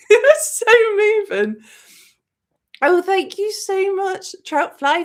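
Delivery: high, loud, teary voice breaking; crying while talking. Real, not acted. Moved to tears by the film's ending, voice breaking. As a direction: let the tears break through the words. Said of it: "without crying".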